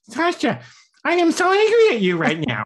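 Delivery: very high, loud squeaky mock anger; silly voice. Shown as a demonstration. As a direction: yell angrily in a silly, sky-high voice. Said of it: "some other silly voice".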